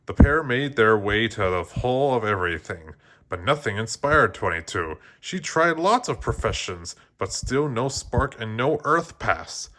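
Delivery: ordinary delivery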